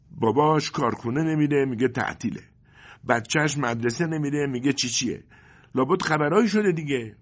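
nagging mother. Acted. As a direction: press him like a fed-up wife. Mother worries aloud. (مادری غرغرو با صدای بلندتر و زیرتر)

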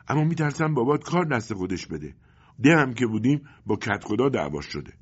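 nagging mother. Acted. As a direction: press him like a fed-up wife. Mother voices her fear. (مادری غرغرو با صدای بلندتر و زیرتر)

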